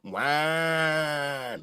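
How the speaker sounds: one long, stretched-out whine; mock whining. Host echoing a friend's catchphrase, mock whining. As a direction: drag the word out like a whiner. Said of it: "why does he be whining".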